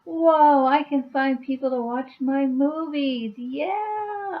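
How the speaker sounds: high, loud burst of retold wonder; retold excitement. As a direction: gush like a kid discovering YouTube. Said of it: "I was like".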